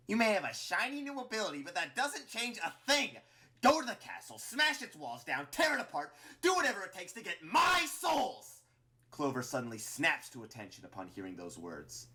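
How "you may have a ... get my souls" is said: high, loud villainous rant; furious command. A narrator's reading. Flowey ordering Clover to the castle, furious command. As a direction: drive the orders home, building to a yell.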